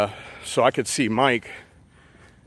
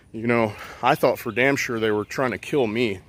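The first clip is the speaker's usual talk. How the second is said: ordinary talk, nothing marked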